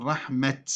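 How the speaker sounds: "رحمة" ending in an audible t; pausal ta. Shown as a demonstration. Teacher showing another dialect, "رحمة" with final t. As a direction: stop on "رحمة" as rahmat. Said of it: "ينطقون بالتاء تاء عند الوقف".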